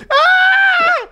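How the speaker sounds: very high, loud mock scream of pain. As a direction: let out a shrill yelp of pain. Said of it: "Like, he was".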